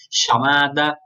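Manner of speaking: chamada said sha-mada, ll like Portuguese CH. Shown as a demonstration. Teacher demoing the sh ll for students. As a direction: soften chamada to a sh sound. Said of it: "quase como um C H".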